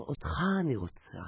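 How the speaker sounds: soft, husky come-on; soft seductive murmur; seductive whisper. Acted. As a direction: breathe it seductively. Beauty seduces.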